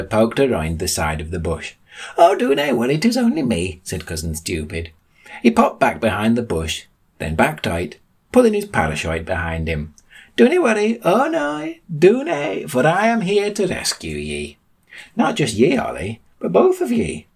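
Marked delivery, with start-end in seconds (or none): 2.2-3.8 high, singsong daft rescuer; Cousin Stupid. A narrator's reading. Colander-wearing cousin pops up from a bush, high reassurance. 10.4-17.3 high-pitched heroic proclamation; Cousin Stupid. A narrator's reading. Colander-wearing cousin announces he has come to rescue, high proclamation.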